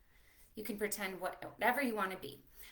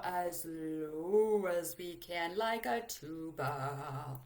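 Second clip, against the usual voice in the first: low slow rumble; slow tuba-low voice; 5 semitones lower, slower than usual. Shown as a demonstration. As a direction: drop it deep like a tuba. Low voice next.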